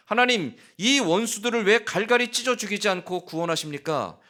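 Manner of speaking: high, loud indignant shouting at God; furious outcry. Acted. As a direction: hurl the questions upward in rage. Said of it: "요나가 지금 하나님을 향해서 성내며 분노하고".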